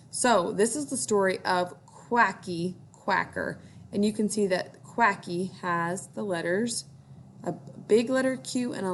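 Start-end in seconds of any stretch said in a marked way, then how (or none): none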